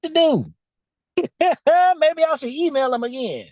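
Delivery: high, puzzled, with a laugh; unsure inner voice second-guessing; high bewildered musing; confused current self. Retold or imitated. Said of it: "My current self was confused".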